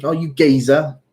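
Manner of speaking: imitated geezer accent with a lift; badly copied geezer accent; Wuhan Lawai. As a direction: mimic a friend's teasing geezer accent. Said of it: "the Giza accent that he uses".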